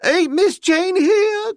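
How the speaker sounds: very high, slow wailing cry; high slow sobbing wail; wailing maid. A narrator's reading. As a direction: wail in terror with eyes shut. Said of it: "cried Esmeralda".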